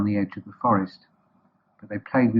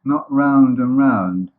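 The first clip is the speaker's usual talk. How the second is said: very slow, louder, drawn-out words; ponderous correction; 4 semitones higher, 7 dB louder, much slower than usual. Acted. Eeyore correcting boastful Roo, slow and weighty. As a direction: stretch each word with weighty scorn.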